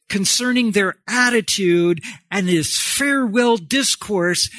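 ordinary delivery

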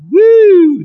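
high, loud woo; playful high-pitched "woo"; delighted woo. Pretend. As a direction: let out a high playful whoop. Savouring the silk underwear.

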